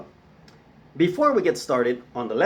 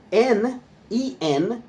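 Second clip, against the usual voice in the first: ordinary delivery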